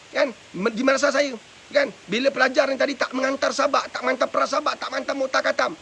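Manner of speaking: normal talking voice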